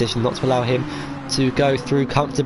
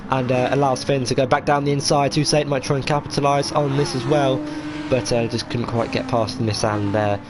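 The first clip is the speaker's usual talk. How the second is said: live race commentary, rising with action; motorsport commentator; 2 semitones higher than usual. Real, not acted. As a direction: call the racing as it unfolds.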